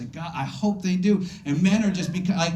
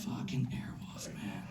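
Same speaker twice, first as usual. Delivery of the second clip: hushed, slow whispered aside; 10 semitones lower, 17 dB quieter than usual. Real, not acted. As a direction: breathe it out like a private curse. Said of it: "whispered aside".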